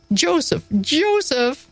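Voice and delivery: put-on high-pitched call for a lost child. A narrator's reading. Teacher searching for the lost boy, calling out. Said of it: "I called".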